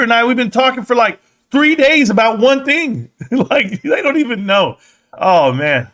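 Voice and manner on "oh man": ordinary talk, nothing marked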